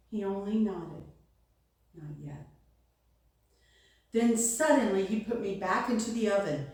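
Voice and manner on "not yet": low, hushed, patient reply; quiet refusal. A narrator's reading. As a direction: drop right down, soft and low. Master nods and refuses.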